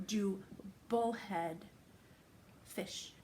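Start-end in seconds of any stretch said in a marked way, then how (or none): none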